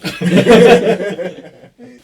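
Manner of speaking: bursts out laughing loud; a big real laugh at the punchline. Real, not acted. Friend's story about not being recognised.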